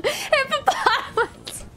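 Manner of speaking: high laughing yell. Shown as a demonstration. Yelling the word while chat laughs. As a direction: shout it through laughter. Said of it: "Why the fuck is she yelling?".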